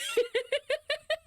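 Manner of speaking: shrieking giggle; high squealing laugh. Real, not acted. Laughing after excited quote.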